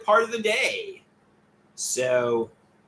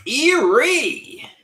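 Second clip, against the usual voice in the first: excited greeting; 9 semitones higher, 6 dB louder than usual. Real, not acted. Greeting a new chat arrival.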